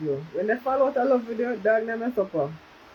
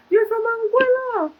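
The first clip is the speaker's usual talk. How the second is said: imitating mother; 13 semitones higher, 4 dB louder than usual. Mother's voice in retold chat.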